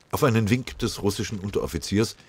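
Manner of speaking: ordinary delivery, nothing marked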